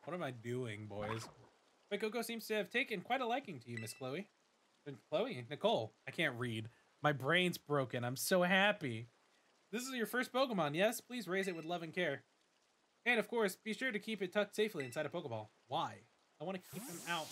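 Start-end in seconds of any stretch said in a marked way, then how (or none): 1.9-4.3 lightly voiced formal school director; a hint of a polite headmaster welcoming a student; Director Clavel. Director greets the new student, lightly formal voice. 9.7-15.5 lightly voiced formal school director; a hint of a polite headmaster welcoming a student; Director Clavel. Director greets the new student, lightly formal voice.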